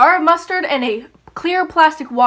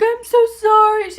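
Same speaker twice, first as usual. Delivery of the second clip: high, whiny fake sob apology; fake crying; 9 semitones higher than usual. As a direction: fake a teary sorry, pitched up. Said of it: "So I fake cried in front of the cops".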